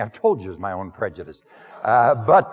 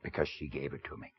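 lightly voiced humble outsider, plain-spoken; first-person sermon character; 3 semitones lower than usual. Preacher speaking to the congregation as the Samaritan woman's man, lightly voiced. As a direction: step into the role of the woman's man, understated.

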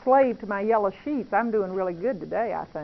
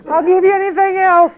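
louder, higher laughing bargain with God; laughing plea; 7 semitones higher, 14 dB louder than usual. Real, not acted. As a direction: laugh it out, pleading.

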